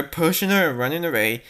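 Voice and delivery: ordinary delivery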